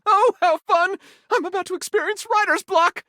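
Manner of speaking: thrilled beyond words; giddy excitement. Real, not acted. Thrilled about coming writer's block.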